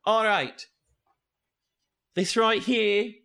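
high, loud character voice; high-pitched tour guide showing the way; Gallagher's voice. Acted. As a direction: announce it up high to the kids. Game master voices Gallagher.

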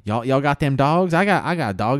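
a hint of a Southern American twang; mild Southern accent; lightly Southern. Imitating Southern American soldier.

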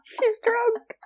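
high-pitched, laughing through it. Retold or imitated. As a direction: squeal it out mid-laugh. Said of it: "I was like".

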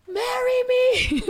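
high, drawn-out mock plea; drunken proposal. Telling friends what she said to a stripper, gushing. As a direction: call it out high and slow.

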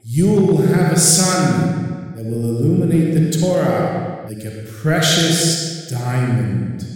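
slow, sung heavenly proclamation. Retold or imitated. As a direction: chant it like a voice from heaven. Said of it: "I just heard a voice".

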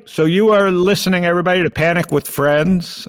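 ordinary talk, nothing marked

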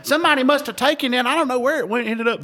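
high whiny imitation of a complaining friend; mock whining. Mocking a friend whining about his lost bottle, high and whiny. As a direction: whine it high like a sulky kid. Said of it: "Whining and complaining".

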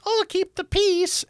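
high-pitched mocking squeak; squeaky mock plea to behave; mock peacekeeper voice. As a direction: put on a silly, very high voice. Mocking peacekeepers in a retold exchange.

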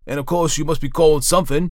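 loud, high-pitched insistent judge; loud official impatience; stern magistrate. A narrator's reading. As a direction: press the prisoner from the bench. Magistrate presses the prisoner for name.

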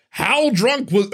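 high, slow, drawn-out impersonation; Al Pacino impression. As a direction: stretch the words out high in a hammy actor impersonation. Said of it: "I can't do a good Al Pacino voice".

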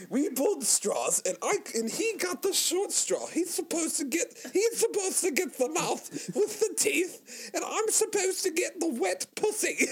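high-pitched mock British accent. Co-host voices an aggrieved man who drew straws, British accent. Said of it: "I went to do a British accent".

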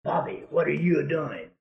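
softly retold call to a dog; understated, quiet coaxing; easy, hushed call. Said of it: "just right easy to him".

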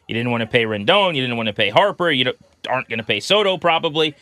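ordinary delivery

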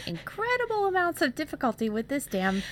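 loud, high exasperated outburst; building comic exasperation. Real, not acted. Griping about troubled recording.

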